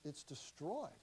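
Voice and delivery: ordinary talk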